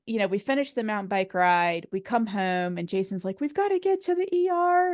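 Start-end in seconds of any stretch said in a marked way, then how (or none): 3.4-4.9 urgent panicked husband. Retold or imitated. Husband urging her to the ER.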